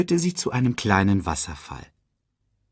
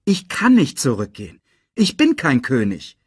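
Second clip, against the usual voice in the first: insisting; 4 semitones higher, 5 dB louder than usual. Acted. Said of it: "beharrte er".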